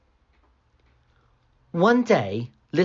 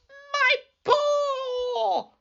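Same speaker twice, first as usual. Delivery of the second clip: high crying wolf yelp; wolf crying out over his paw; pained wolf cry. A narrator's reading. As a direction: wail as the wolf's paw gets trodden on. Wolf's paw stepped on.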